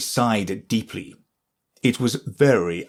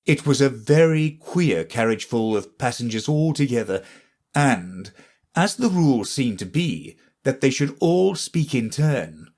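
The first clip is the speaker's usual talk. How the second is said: ordinary delivery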